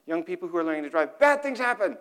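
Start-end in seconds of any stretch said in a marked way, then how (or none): 1.2-2.0 emphatic warning. Real, not acted. Punchline repeated.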